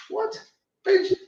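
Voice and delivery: real disbelief, voice leaping very high; stunned outrage at the casting choice; an incredulous squeak of disbelief; incredulous what. Real, not acted. Outraged at Boyega's accent being Americanized.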